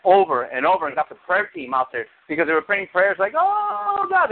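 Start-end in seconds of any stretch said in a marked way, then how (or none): 3.4-4.3 mourning. Retold or imitated. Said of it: "They were almost mourning".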